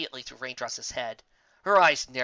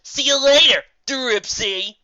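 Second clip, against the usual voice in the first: high, loud teasing sing-song; mocking farewell; 10 semitones higher, 10 dB louder, slower than usual. Gilda taunting Raindrops with a mock salute, teasing. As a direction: taunt her as you fly off. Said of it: "offer up a mock salute".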